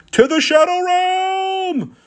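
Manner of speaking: very high, slow, drawn-out announcer cry; dramatic meme shout. Pretend. Taunting a beaten player, a very high drawn-out mock cry. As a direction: bellow it out like a cartoon villain banishing someone.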